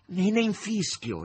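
high, loud and slowed; defiant retort. A narrator's reading. Margherita shouting back to the master from the corridor, defiant. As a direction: fling it back carelessly, drawn out.

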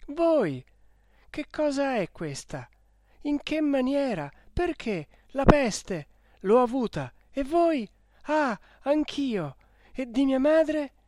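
high, loud, urgent questions; volley of amazed exclamations; excited astonishment. A narrator's reading. Lovers reunited in the plague hospital, astonished and urgent.

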